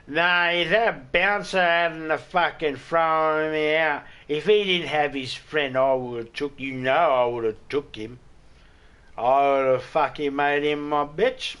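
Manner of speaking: loud, slow hammed-up drunk bragging; drunk tough talk. Drunk at the taxi rank bragging about the bouncer, loud slow mock voice. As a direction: brag like a kicked-out drunk. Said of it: "one that'll pipe up going".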